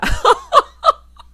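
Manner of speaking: high, explosive laughter; loud cackle. Real, not acted. Host floored by the secret son reveal, loud high cackle. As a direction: burst out cackling.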